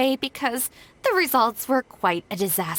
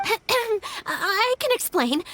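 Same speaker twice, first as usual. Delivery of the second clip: awkward throat clearing; 10 semitones higher, much slower than usual. Acted. Caught out by the flyers.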